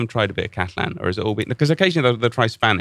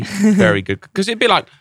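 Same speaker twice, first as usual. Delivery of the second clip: ordinary talk, nothing marked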